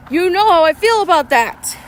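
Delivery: ordinary delivery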